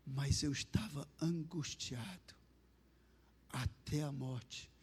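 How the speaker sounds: slow, low and hushed; retold voice of Jesus, weighing each word softly; anguished divine voice. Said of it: "aquela voz falou assim pra mim". (voz divina angustiada, baixa e lenta)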